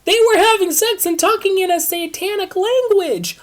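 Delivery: high, loud frantic squeal; panicked shriek. Acted. Triss shrieks the news to Christina in panic.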